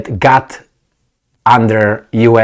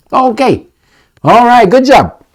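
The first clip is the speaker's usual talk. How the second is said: much higher, louder mock "good job"; sarcastic praise; 11 semitones higher, 4 dB louder than usual. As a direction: applaud them with heavy sarcasm.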